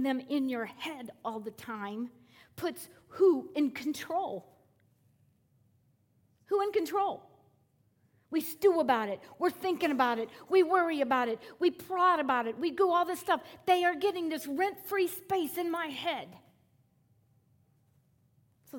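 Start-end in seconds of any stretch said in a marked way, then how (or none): 2.5-7.2 who drawn out and lifted; questioning the crowd, who stretched and high; stress on who. Acted. Rhetorical question to congregation. 8.3-16.3 louder, higher preaching run; preacher building to a loud peak. Acted.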